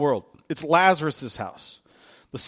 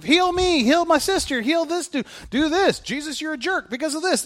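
screaming crowd; 11 semitones higher than usual. Retold or imitated. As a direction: beg him like a desperate crowd.

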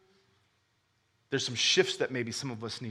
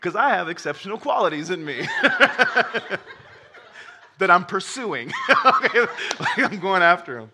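laughing; 5 semitones higher, 7 dB louder than usual. Real, not acted.

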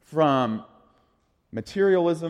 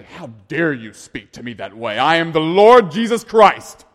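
slow, booming, indignant lord; mock divine rebuke; 11 dB louder than usual. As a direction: thunder it like an offended king. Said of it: "he does not say".

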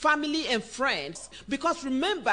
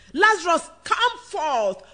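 loud summons; 3 semitones higher, 6 dB louder than usual. Acted. Preacher voicing Jesus at the tomb, loud calling. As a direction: call out as if raising the dead. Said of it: "he called with a loud voice".

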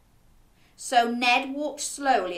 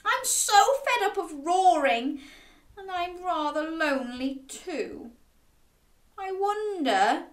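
high, forlorn dragon voice; sad, sniffly storybook dragon; sniffling; 7 semitones higher than usual. A narrator's reading. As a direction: confess loneliness in a small, high voice. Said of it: "sniffed the dragon".

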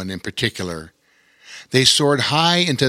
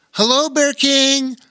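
put-on boys greeting; 14 semitones higher, 3 dB louder than usual. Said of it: "said in unison".

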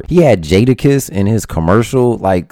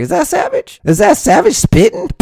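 surprised listener. Retold or imitated. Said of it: "you're gonna be like".

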